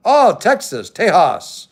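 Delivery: bright jump up on Texas, then Tejas; surprised. Real, not acted. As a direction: say it like the news caught you off guard. Reacting to a viewer's location.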